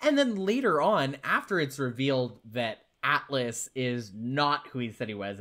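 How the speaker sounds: ordinary delivery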